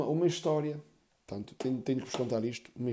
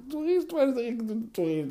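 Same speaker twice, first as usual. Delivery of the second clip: giggly mother; 9 semitones higher than usual. Retold or imitated. Mother giggling as she tells it.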